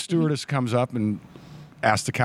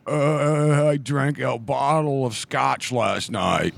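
hungover voice; much slower than usual. Retold or imitated. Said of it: "Did I sound like that".

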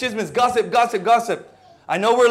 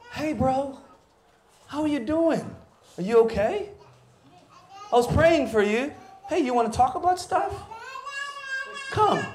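softer, gentle check-in voice; caring brother; 5 semitones higher, 5 dB quieter than usual. Pretend. Preacher plays a brother checking on a friend, softly. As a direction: ask softly like a concerned friend.

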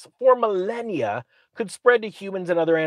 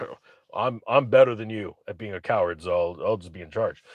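lower, softer smug voice; mock coward voice; 9 semitones lower, 5 dB quieter than usual. The head cowards claiming charge, lower and softer. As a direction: mumble it like a smug weakling.

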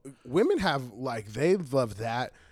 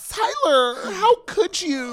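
much higher, louder, slower, crying plea; mock heartbroken wail; 6 dB louder, much slower than usual. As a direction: wail it like a betrayed girlfriend. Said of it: "A girl crying and, or, like, mad".

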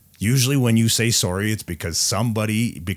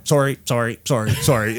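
rapid, repeated mock apologies, pitched up; mocking bird-like chorus; 3 semitones higher than usual. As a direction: parrot it mindlessly like squawking birds. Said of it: "you sound like a bunch of those".

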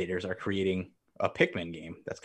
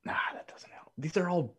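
half-whispered aside, breathy; whispering to himself; 11 semitones higher, 6 dB quieter than usual. Real, not acted. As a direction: mutter it under your breath while searching. Muttering while searching online.

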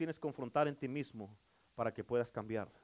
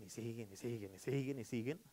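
trailing off softer and softer; fading repetition; 7 semitones lower, 10 dB quieter than usual. Acted. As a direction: let each y siguen sink quieter. Preacher repeating, voice fading. (repetición que se apaga, cada vez más suave)

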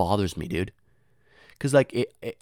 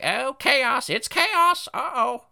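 much higher squawking parrot cry; alarmed parrot; 6 dB louder, much slower than usual. Retold or imitated. Parrot squawking over classroom chaos, much higher. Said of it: "The parrot was like".

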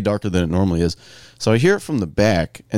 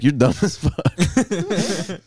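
slow, drawn-out, higher mock insult; 7 semitones higher, much slower than usual. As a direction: stretch out the insult, half-laughing. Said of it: "I'm like".

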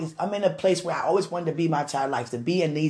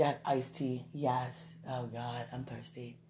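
ordinary talk, nothing marked

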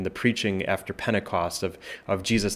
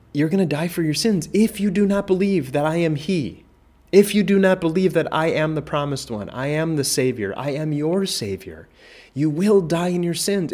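high, louder, longing plea; pleading; 7 semitones higher, 4 dB louder than usual. Acted. Jesus pleading with people who reject him, high and longing. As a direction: beg them to believe, voice lifted. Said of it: "It's a plea".

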